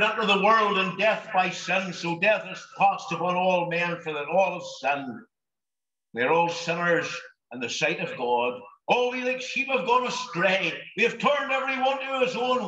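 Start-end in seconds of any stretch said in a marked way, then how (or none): none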